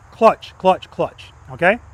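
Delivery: each clutch tapped out in a steady beat; rhythmic clutch cue. Shown as a demonstration. Instructor drilling students on clutch rhythm, steady beat. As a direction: count out the clutch feel like a coach. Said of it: "Open the clutch".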